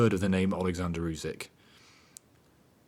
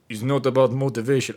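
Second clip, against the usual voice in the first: brief put-on boxer voice, a touch louder; imitating Usyk; 4 semitones higher, 6 dB louder than usual. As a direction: quote the boxer in his own voice. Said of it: "I'm not gonna try that".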